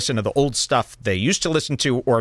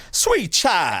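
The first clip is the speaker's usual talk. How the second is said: imitating Southern lawyer; 5 semitones higher than usual. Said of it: "Where he starts going".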